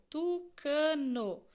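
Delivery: tu-ca-no dito bem devagar, sílaba por sílaba; syllables split. Shown as a demonstration. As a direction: separe tucano em sílabas, lentamente. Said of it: "as duas primeiras sílabas ficaram em uma linha". (tucano pronunciado devagar, sílaba a sílaba)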